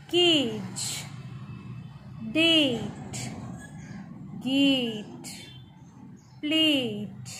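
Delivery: every word from केज to एज with its A drawn out; long vowel. Shown as a demonstration. Teacher reading the book's word list, long A in केज through एज. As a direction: stretch the ए in केज, डेट, गेट, plate, slate, पेन, मेन, एज. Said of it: "लंबा पढ़ रहे हैं".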